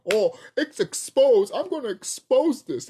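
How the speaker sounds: high, slow, hammed-up mock gasp; slow sarcastic impression of clueless people; mocking quote. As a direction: drag it out, mimicking know-it-alls. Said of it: "and be like".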